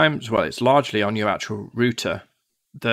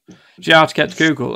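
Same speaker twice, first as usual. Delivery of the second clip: fast, louder mock question; 4 semitones higher, 6 dB louder, much faster than usual. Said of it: "you'll go".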